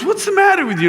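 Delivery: high-pitched mock scolding; imitated mother at wit's end; exasperated mom. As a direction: scold your kid in a high voice. Exasperated mom scolding her child.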